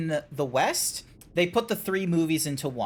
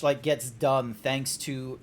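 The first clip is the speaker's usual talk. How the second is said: ordinary delivery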